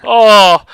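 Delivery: exasperated groan. Real, not acted. Gamer tired of the same four songs, loud exasperated groan.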